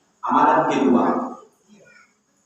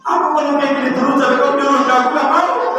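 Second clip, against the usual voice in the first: much higher, fast mock whining; whiny husband; 6 semitones higher than usual. Hurt husband whining back at his wife, high pitched. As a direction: whine like a sulking husband. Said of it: "kata dia".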